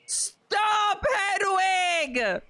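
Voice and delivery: squealed, stretched-out plea to stop; high shout. Real, not acted. Reader calling out to Hedwig, high drawn-out shout. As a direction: shout it high, sliding down at the end. Calls out to the character.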